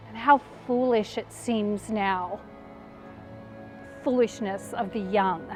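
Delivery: low, soft and rueful; hushed, disillusioned reflection; bitter regret. Acted. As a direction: sink into quiet regret. Mary regrets her lost hopes.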